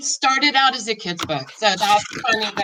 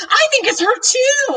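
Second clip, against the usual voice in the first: much higher, raised excited call; excited yell; 4 dB louder than usual. A narrator's reading. Girl yelling to her dad about the cat, excited high call. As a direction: call it out, thrilled. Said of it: "I yell".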